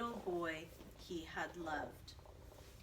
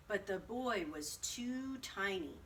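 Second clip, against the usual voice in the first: ordinary delivery, nothing marked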